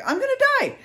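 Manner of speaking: very high-pitched dread; retold panic. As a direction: squeal it as if acting out your last moment. Retells panic as bear approaches.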